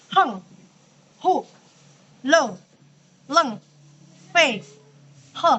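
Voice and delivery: "Heng", "Hu", "Lo", "Leng", "Fei", "He", each falling sharply; fourth tone. Shown as a demonstration. Teacher drilling tone four examples, short firm falls. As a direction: separate firm syllables from "Heng" to "He", each dropped quickly. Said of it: "Itu dia nada empat".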